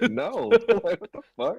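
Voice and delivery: high laugh into what the fuck; high incredulous laughing outburst; laughing disbelief. Retold or imitated. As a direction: crack up in disbelief. Reacts to friend's question.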